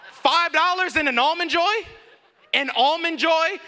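high-pitched, incredulous outrage; mock offended. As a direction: repeat it as a scandalized question. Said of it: "I felt so deeply offended".

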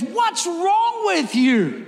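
high, slow mock outrage; lady yelling from car. Lady in the car yells at him, high and outraged. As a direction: squeal it like a shocked driver. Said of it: "she goes".

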